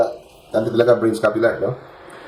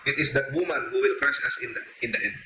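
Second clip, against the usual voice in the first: much softer, hushed demon confession; devil admitting; 11 dB quieter than usual. Retold or imitated. Priest voicing the devil's defeat to the congregation, hushed. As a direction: let it sink to a fearful hush. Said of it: "Inamin ng Diablo".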